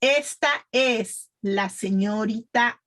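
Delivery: slow teacher's model, word by word; pronunciation practice. Shown as a demonstration. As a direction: lead the class through it slowly. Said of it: "practice with me, please".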